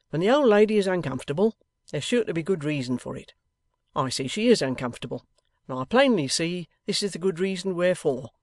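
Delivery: emphatic, lightly voiced plain-spoken husband; blunt old man's reproof. A narrator's reading. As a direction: state your objection firmly and bluntly.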